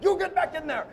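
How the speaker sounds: high mock Indian accent; hammed-up Indian producer order; pitiful Indian accent. Indian producer sending Eli back, mock Indian accent. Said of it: "my pitiful Indian accent".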